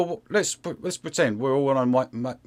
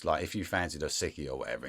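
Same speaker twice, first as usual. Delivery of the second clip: ordinary delivery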